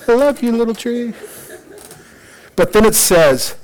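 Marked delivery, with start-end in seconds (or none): 0.1-1.1 high, soft lovey-dovey coo; doting Jonah. Acted. Jonah talking to his beloved little tree, doting. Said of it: "he loves his little tree".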